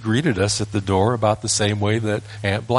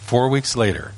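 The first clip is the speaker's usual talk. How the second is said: ordinary talk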